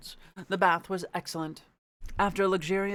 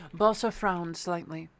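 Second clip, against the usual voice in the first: usual speaking voice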